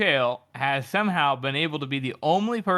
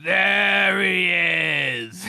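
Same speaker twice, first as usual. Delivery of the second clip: drawn-out announcement; 2 semitones higher, 3 dB louder, much slower than usual. Acted.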